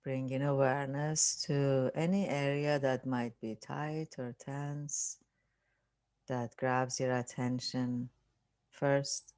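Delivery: unhurried meditation voice with long pauses; guided meditation. Acted. Teacher leading listeners through relaxing the body, guided meditation. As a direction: calmly guide them through a body scan, leaving space.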